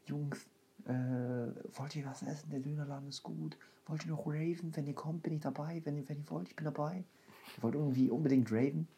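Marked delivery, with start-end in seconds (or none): 0.1-7.0 lisping. Retold or imitated. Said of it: "der hat auch gelispelt".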